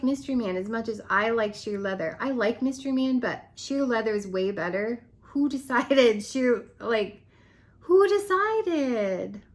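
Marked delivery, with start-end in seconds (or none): 5.2-9.4 indignant disbelief. Real, not acted. Vlogger protesting that her favorite scent was cut, slow and outraged. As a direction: stretch out the mock outrage.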